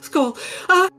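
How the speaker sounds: high, shivering, fussy flamboyant voice; a cold, fretful dandy pleading; campy drag queen. Acted. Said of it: "he'll say".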